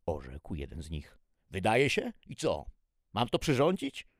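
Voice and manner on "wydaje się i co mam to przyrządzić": loud, incredulous questioning; doubtful. A narrator's reading. Cook questioning the testers about preparing it, loudly doubtful. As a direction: challenge it, pitch jumping up. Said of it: "wyraził wątpliwość kucharz".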